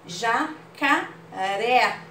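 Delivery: Jacaré slowly, final é wide open; open vowel demo. Shown as a demonstration. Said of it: "Olha como abriu onde tem o acento". (Jacaré devagar, com é bem aberto)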